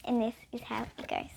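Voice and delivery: hushed storytelling whisper. Acted. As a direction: lean in and whisper it.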